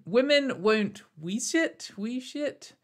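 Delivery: WEE SHIT attempted slowly with a questioning lift; unsure Scottish word. Real, not acted. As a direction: try out WEE SHIT like an unknown Scots word, rising at the end. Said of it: "I don't know how to say it".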